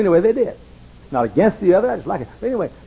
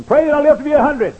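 pleading; 10 dB louder than usual. Retold or imitated. Old man pleading for prayer.

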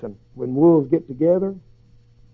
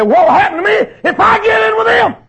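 pleading question; 10 dB louder than usual. Retold or imitated. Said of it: "You say".

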